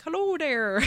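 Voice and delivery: high, loud cartoonish Irish brogue; hammed-up Irish greeting; mock Irish accent. Storyteller imitates the Irish stranger on the bike, mock Irish accent. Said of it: "he has that charming Irish accent".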